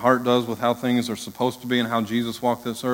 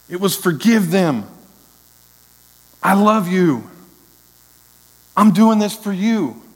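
rising, louder preacher's climax; preaching at full force; 7 semitones higher, 5 dB louder than usual. Acted. Pastor preaching the cross to his church, swelling loud. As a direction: build the sermon to a high, loud peak.

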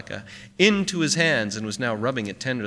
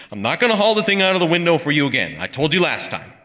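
loud, high, fast outraged refusal; fed-up pawnbroker's scolding; 7 semitones higher, 8 dB louder than usual. A narrator's reading. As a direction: rattle it off in loud exasperation. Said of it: "outraged by so much intensity".

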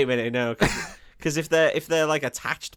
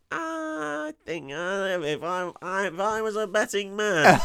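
slow, hesitant, hammed-up hedging; doubting pirate; 2 semitones higher, slower than usual. Sceptical pirate answering his crewmates, slow and hedging. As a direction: stall with drawn-out reluctance. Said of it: "And he's like".

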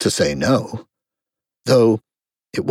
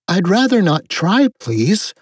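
put-on higher girlish refusal; polite girl Alice; 8 semitones higher than usual. A narrator's reading. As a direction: decline politely in a high voice.

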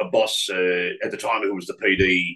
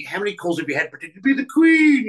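higher, louder mock disbelief; incredulous listener; 10 semitones higher, 4 dB louder, faster than usual. Anybody hearing the prank, higher mock disbelief. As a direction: sneer it like an obvious question. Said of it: "would go".

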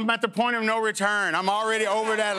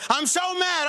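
mock outrage; 8 semitones higher than usual. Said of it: "and they're going".

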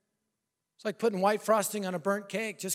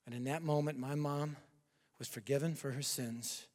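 much softer, lower, intimate invitation; hushed altar call; 9 semitones lower, 13 dB quieter than usual. Acted. Preacher inviting the unsaved to Christ, softly and intimately. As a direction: lean in and speak to each listener alone.

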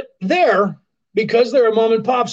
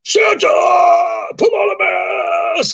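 very high, loud shouted order; yelling at Santa; 12 semitones higher, 5 dB louder than usual. Pretend. Imagined clerk yells at Santa.

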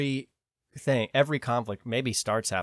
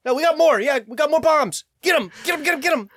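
very high, loud, frenzied egging-on; hammed-up manic cheering for an attack; mock warmonger; 8 dB louder, faster than usual. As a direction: urge them into battle in a squeal. Acts out US handing out bombs.

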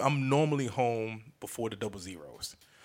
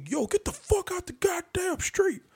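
much higher, slow mock yell; yelled out window; 4 dB louder than usual. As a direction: holler it out the car window. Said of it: "I yell out the window".

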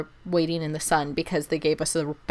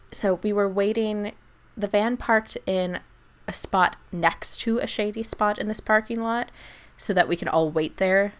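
ordinary talk, nothing marked

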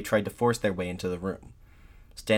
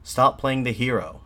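slow, louder, higher jeer; narrated heckle, raised voice; heckler's shout; 5 semitones higher, 6 dB louder than usual. A narrator's reading. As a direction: shout it up at the speaker, drawn out. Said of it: "another heckler shouted".

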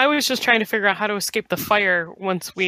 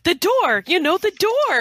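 mock shout, pitched way up; mock yelling; 11 semitones higher, slower than usual. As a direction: imitate calling out urgently in a high voice. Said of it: "we kept yelling at you".

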